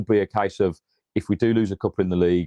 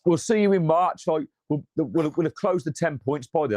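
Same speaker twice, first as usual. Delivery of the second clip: mocking a fan; 6 semitones higher than usual. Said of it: "Man United fans on TikTok yesterday going".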